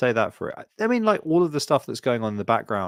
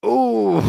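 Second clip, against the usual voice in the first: high cringing ooh; 12 semitones higher, 6 dB louder than usual. Retold or imitated. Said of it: "And you're like".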